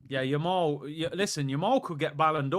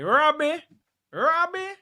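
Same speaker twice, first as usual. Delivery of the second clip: high, loud call to a friend; yelling Robbie's name; 12 semitones higher, 8 dB louder, slower than usual. Real, not acted. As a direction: shout his name across the room. Calling out to Robbie.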